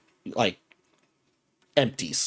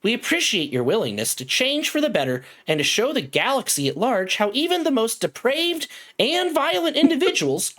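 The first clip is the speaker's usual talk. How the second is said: high-pitched, singsong automated voice; synthetic, measured announcement pitched well up; robotic announcer; 10 semitones higher than usual. Acted. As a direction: recite it like a cheery corporate computer. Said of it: "that same robotic voice".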